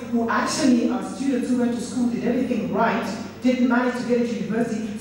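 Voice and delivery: usual speaking voice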